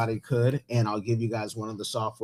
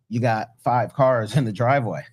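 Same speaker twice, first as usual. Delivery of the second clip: ordinary talk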